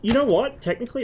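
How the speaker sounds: jumps up high and loud; sudden realization. Real, not acted. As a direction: blurt it out suddenly. Sudden realization mid-argument.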